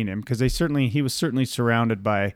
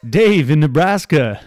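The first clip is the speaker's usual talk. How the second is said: hammed-up radio host, louder; 4 semitones higher, 7 dB louder than usual. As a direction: announce the next caller like a talk-radio host. Mock radio host takes calls.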